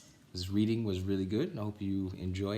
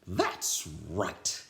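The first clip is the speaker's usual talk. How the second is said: stern witch voice; 2 semitones lower than usual. Acted. Viola Swamp speaking.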